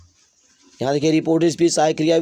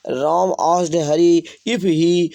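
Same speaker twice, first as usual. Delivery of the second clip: slow dictation; much slower than usual. Shown as a demonstration. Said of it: "dictating slowly".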